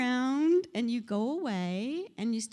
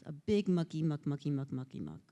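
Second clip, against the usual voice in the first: ordinary talk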